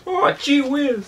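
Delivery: put-on high, slow gee-whiz kid; a hint of a wide-eyed 1950s kid; wholesome 1950s kid. Trading old-timey golly phrases.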